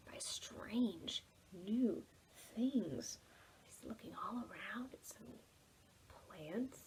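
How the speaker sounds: soft, unvoiced whisper; hushed narration. A narrator's reading. Storyteller to children about the strange woods, whisper. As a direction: breathe the words like a secret.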